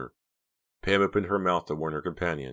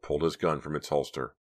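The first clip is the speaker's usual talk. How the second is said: ordinary delivery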